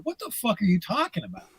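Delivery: high, incredulous retort at soft volume; indignant protest. Retold or imitated. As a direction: retell a baffled, offended comeback. Retold protest at being thrown out.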